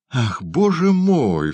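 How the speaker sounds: hammed-up slow, high exclamation; crying out. A narrator's reading. Young woman making a show of finding Oliver, crying out. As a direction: draw out a loud-hearted cry to God. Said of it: "вскричала молодая женщина".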